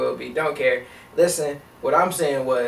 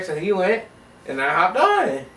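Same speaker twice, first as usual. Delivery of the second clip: ordinary talk, nothing marked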